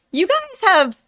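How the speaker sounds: gushing thanks, choked up and excited; grateful youtuber getting emotional with her viewers. Real, not acted. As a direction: voice jumps high with emotion. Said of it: "Now I'm gonna get emotional".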